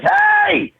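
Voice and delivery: the relentless California sun shouting you awake; the sun calling out. Pretend. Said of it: "every day it's like".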